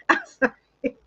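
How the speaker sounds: high, squeaky apology through laughter; laughing apology. Real, not acted. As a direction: giggle out the apology. Laughing apology after changing verdict.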